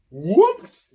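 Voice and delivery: high, loud fake oops; a loud, sing-song "whoops" as the soup goes; mock whoops. A narrator's reading. Page spilling soup on the prince on purpose, loud and high. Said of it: "you say".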